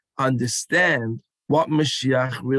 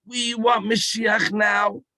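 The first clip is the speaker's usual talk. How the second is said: mild mock scream; 6 semitones higher, slower than usual. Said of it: "We scream".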